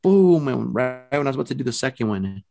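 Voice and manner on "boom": a sharp boom, higher and louder, like a fist on the wall; mock wall knock. Showing the ghost how to knock, loud knock sound. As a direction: punch out a single knock on the wall. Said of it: "And I went".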